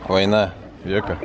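normal talking voice

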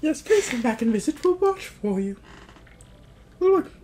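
high cartoon well-wisher; grateful villager. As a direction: call a high-pitched farewell. Voices villager character in game.

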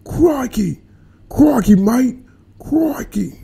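very high-pitched mock Australian; Australian accent. Shown as a demonstration. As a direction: squeak it out in a cartoonish Australian accent.

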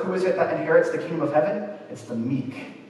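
ordinary talk

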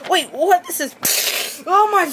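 very high, loud shocked cry; shocked shriek. Acted. Contestant hearing about elimination into the sun, shocked high shriek. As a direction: recoil in horror.

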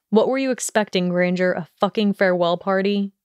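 plain reading voice

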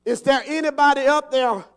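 acted yell to someone far above. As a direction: holler up from a cliff for anyone. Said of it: "he yells out".

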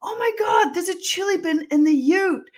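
high-pitched breathy mock yell; retold excited yelling. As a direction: call it out high and thrilled, re-enacted. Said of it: "I just remember yelling out".

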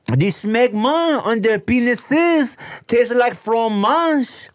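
high, slow mock French accent; fake French accent. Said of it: "Fake French".

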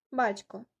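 usual speaking voice

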